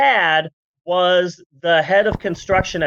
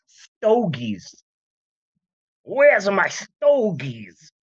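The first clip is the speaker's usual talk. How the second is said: put-on old-timey cigar man; much slower than usual. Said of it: "They were all smoking cigars".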